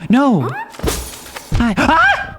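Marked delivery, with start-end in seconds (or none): 1.8-2.4 sudden high-pitched shriek; real high startled cry; startled scream. Real, not acted. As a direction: yelp in surprise. Startled while playing the game.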